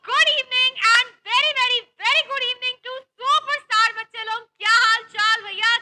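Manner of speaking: loud, slow, drawn-out welcome; energetic greeting. Real, not acted. Teacher opening a live class, loud drawn-out hello.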